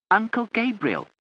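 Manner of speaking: loud, high call; shouted repeat. A narrator's reading. Mike repeating for the deaf aunt, loud. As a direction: shout it again for her.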